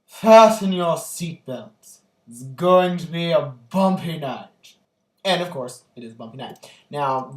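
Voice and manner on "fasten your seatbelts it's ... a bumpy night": slow, much louder dramatic delivery of the line; famous movie line. Retold or imitated. Quoting the film's famous line to viewers, slow dramatic boom. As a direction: ham up the classic film quote, slow and grand. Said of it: "the famous line is".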